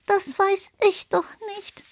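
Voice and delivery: hohe, leisere, hilflose Stimme; verzweifelter Karl. Acted. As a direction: klinge ratlos und klein. (Karl antwortet hilflos, viel höher und leiser)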